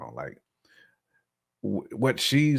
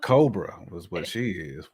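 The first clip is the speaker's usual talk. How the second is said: usual speaking voice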